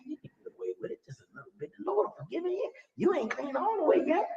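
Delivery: high hissed temptation; tempting inner voice. Retold or imitated. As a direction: coax yourself into sin in a hush. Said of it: "there's another voice saying".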